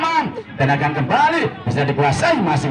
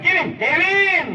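excited; slower than usual. Real, not acted. Player on the ball.

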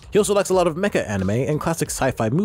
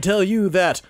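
usual speaking voice

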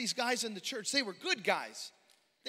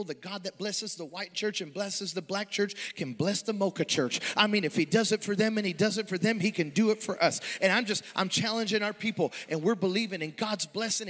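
rising preacher's cry, louder and louder; preaching build; 5 dB louder than usual. Acted. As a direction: lift the congregation with a fervent call. Preaching challenge builds to full force.

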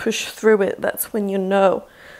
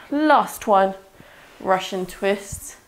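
normal talking voice

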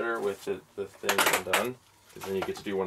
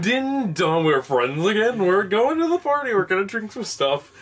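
high, loud hyped-up party guy; party R&B guy impression; 8 semitones higher, 7 dB louder, slower than usual. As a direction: go big like a loud party bro on a sketch show. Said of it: "He's like".

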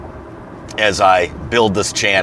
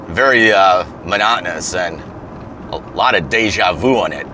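ordinary talk

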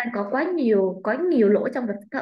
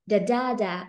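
"da da da" with the middle DA long and strong; second-syllable stress; slower than usual. Shown as a demonstration. Teacher showing stress position two, middle beat of "da da da" stressed. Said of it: "Âm hai là".

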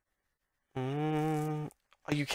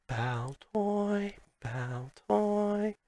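Pokemon cry; 8 semitones higher, much slower than usual. Pretend.

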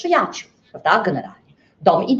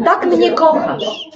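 wolno, z wyższym śmiechem i przekąsem; przeciągnij to kpiąco, śmiejąc się; ironiczny wyrzut; 6 semitones higher, 3 dB louder, much slower than usual. Acted. Żona z ironią do męża. (żona kpi z miłości męża, wolno i ze śmiechem)

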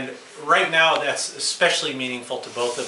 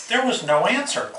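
usual speaking voice

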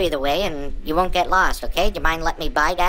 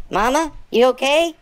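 normal talking voice

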